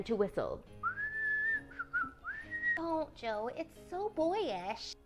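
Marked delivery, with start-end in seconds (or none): 2.8-4.9 high, soft, drawn-out plea; fussy little sister's protest; prim scolding. A narrator's reading. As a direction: chide her in a small high voice. Amy scolds whistling Jo.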